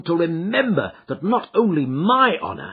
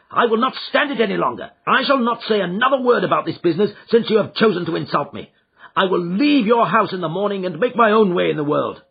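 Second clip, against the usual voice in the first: fast, louder, high defiant refusal; defiant anger; 6 semitones higher, 5 dB louder than usual. Acted. Son defying his father, vowing to leave home. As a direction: slam the door on the conversation.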